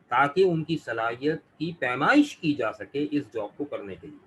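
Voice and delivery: ordinary talk, nothing marked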